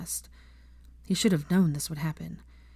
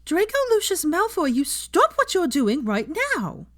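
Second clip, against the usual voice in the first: much higher, loud commanding call; 12 semitones higher, 5 dB louder than usual. A narrator's reading. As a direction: call him back down the corridor. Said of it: "heard her calling out from behind him".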